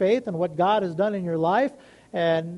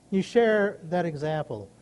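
usual speaking voice

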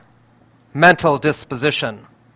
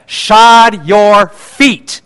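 loud, very slow, each word separate; scripture proclamation; 4 semitones higher, 5 dB louder, much slower than usual. Retold or imitated. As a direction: proclaim the verse as a drawn-out command. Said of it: "And he says".

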